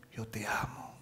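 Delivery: whispered. Shown as a demonstration. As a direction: whisper it, voiceless. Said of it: "le está diciendo". (susurrado con ternura)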